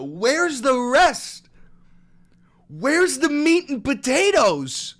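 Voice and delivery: high, loud indignant demand; mock demand. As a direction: demand the missing years. Demanding missing years.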